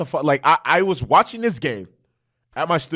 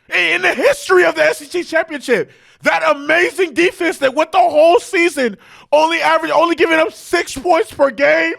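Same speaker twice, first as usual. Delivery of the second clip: incredulous shouting rant; 12 semitones higher than usual. Real, not acted. Fan ranting at Georgia about giving up forty-one, incredulous shout. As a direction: yell like you can't believe the score.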